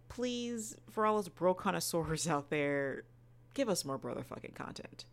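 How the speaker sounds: ordinary talk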